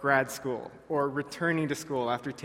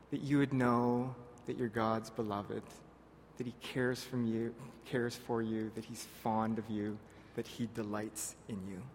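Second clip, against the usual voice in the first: fading softer line by line; reassuring; 5 semitones lower, 8 dB quieter than usual. Real, not acted. Pastor assuring returning students they're loved, softly fading. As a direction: ease down into a gentle assurance.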